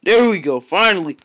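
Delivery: loud relieved shout; relieved cheer. Real, not acted. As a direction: celebrate finally landing it. Relief after landing jump.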